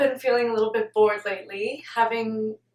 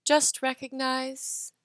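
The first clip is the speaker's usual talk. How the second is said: soft, measured meditation voice; guided meditation; 7 dB quieter than usual. Acted. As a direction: guide listeners gently, pausing between phrases. Leads a meditation.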